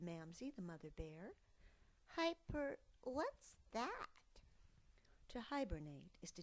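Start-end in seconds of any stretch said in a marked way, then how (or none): 2.2-4.1 high puzzled cub; child mishearing a big word; curious cub. Acted. Cub asks.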